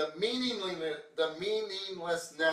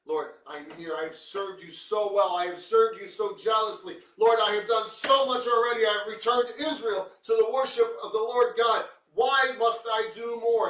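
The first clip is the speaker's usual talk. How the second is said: self-pitying complaint; 8 semitones higher, 12 dB louder than usual. Retold or imitated. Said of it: "Elijah's throwing a pity party".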